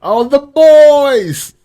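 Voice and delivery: high, loud, drawn-out hype shout; excited cheer. Real, not acted. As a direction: cheer for favourites, stretching the last word. Excited reaction to characters appearing.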